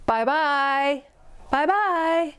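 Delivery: calling goodbye, rising from gruff to high squeaky; three bear voices. A narrator's reading. The three bears calling goodbye to Aluki, stepping up in pitch. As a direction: call out farewell from big bear to little cub. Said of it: "a big gruff voice, a middle-sized voice, and a high, squeaky voice".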